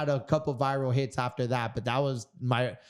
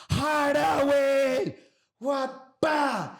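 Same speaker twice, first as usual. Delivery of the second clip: much higher, louder shouted "Harder, Will"; yelling coach; 13 semitones higher, 4 dB louder, much slower than usual. Retold or imitated. Fighter retelling his coach yelling at him during pad work, high shout. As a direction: scream it like a coach driving you at the pads. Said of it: "And he's yelling".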